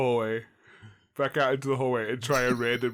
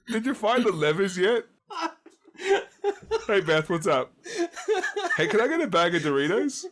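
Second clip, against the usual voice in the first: laughing; 9 semitones higher than usual. Acted. Excited entrance, laughing.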